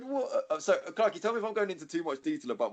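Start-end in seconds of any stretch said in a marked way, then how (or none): 0.0-0.4 stunned. Retold or imitated. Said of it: "I said".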